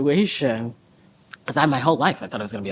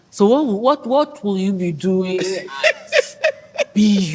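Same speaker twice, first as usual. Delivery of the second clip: uncle's question; much slower than usual. Retold or imitated. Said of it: "he was like".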